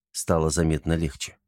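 plain reading voice

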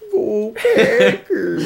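high, slow Minnesota fan cheer; Minnesotan cheer. Pretend. Cheering for the Packers as a goofy Minnesota fan. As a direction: draw it out like a Minnesota sports fan. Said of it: "more like Minnesotan accent".

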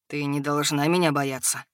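low, gentle rumbling dragon; soft rumble. A narrator's reading. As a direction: reassure her softly, deep. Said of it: "мягко пророкотал дракон". (мягкое рокотание дракона)

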